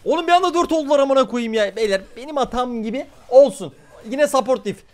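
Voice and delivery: high, loud complaining; frustrated rant. Real, not acted. Streamer blaming support after a lost fight, loud rant. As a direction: vent at the team loudly.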